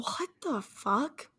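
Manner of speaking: slow, whispered disbelief; drawn-out whisper of real bafflement; stunned whisper. Real, not acted. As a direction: whisper it slowly, appalled. Stunned reaction to the brothel story.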